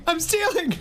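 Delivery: giggling mock panic; hammed-up alarm with laugh. Repeat, cracking up.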